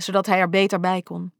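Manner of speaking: ordinary delivery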